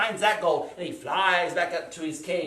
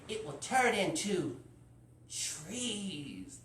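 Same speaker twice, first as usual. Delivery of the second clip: slow, high incantation sinking at the end; casting a spell; 5 semitones higher, slower than usual. Acted. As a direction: chant it slowly, drawing out each phrase. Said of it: "this is the spell that she said".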